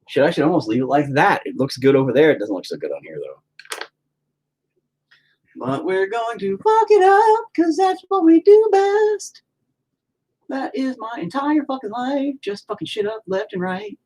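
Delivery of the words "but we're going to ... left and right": high, slow theatrical sing-song; mock sing-song. Mocking his own life, high and slow. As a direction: croon it like a dramatic lament.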